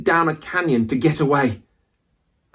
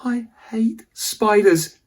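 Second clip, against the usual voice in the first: hissed; 10 semitones higher, slower than usual. Acted. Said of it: "Jake hissed".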